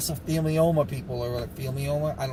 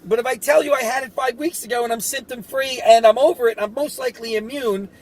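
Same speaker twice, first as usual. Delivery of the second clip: exasperated retort; 6 semitones higher than usual. Retold or imitated. Retold argument with hospital staff.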